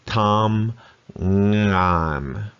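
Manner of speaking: "Tam ngan" split into two level flat-tone syllables; flat tone, syllables apart. Shown as a demonstration. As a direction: say "Tam" then "ngan", both flat and even. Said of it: "Both halves of that word".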